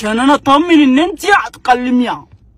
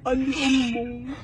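mock grief; 6 dB quieter than usual.

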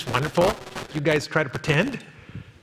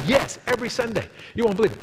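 eager believer; 8 semitones higher, faster than usual. Retold or imitated. Pastor acts eager believer.

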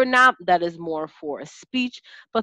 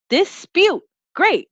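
much higher and louder cheerful praise; enthusiastic praise; 12 semitones higher, 6 dB louder, slower than usual. Shown as a demonstration. Teacher celebrating a pupil's correct word, high and loud. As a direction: cheer the child on. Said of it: "this is what it looks like in action".

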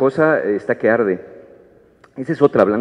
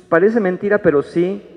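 usual speaking voice

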